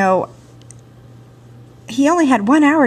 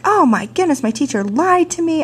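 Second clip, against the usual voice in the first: mock anger; 7 semitones higher than usual. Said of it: "I was angry".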